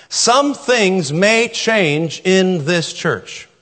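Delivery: very slow, weighted on Some; slow emphasis. Shown as a demonstration. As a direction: let each word land. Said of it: "slow repeated statement".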